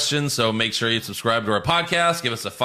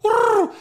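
high hooting owl call, mimicked; playful high owl hoot; owl hooting imitation; 4 dB louder than usual. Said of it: "it's like".

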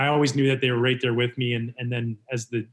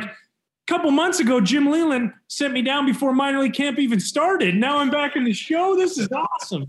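high, raised elated rush; overjoyed disbelief spilling out; excited; 13 semitones higher, 4 dB louder than usual. Retold or imitated. Thinking back on his call-up, high thrilled voice. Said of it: "And I'm like".

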